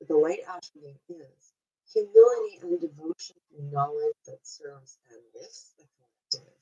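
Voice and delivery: ordinary delivery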